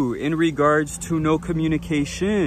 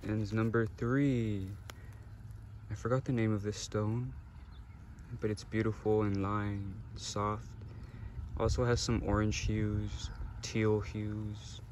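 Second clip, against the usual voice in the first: soft guided meditation; 2 semitones lower, 9 dB quieter than usual. Acted. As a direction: gently guide them through the choice. Soft stone-selection meditation segment.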